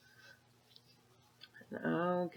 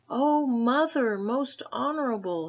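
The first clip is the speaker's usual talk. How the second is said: panting; 7 semitones higher, 7 dB louder than usual. A narrator's reading. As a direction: gasp it out as a winded boy. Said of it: "he panted".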